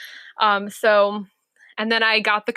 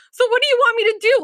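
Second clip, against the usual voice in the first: exasperated; 12 semitones higher, 4 dB louder, faster than usual. Retold or imitated. Frustrated quoted self at examiner.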